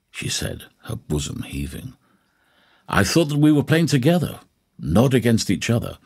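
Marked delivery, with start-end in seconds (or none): 2.9-6.0 higher-pitched, good-humoured Holmes; jovial. A narrator's reading. Holmes answers his hostess's compliment after playing together, jovially. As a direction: say it lightly, with jovial lift. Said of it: "said Holmes jovially".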